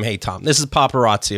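usual speaking voice

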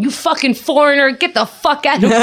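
police yelling. Retold or imitated. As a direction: play the angry cop shouting back. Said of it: "they're going".

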